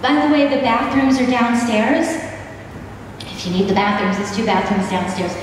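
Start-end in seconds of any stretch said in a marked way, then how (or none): none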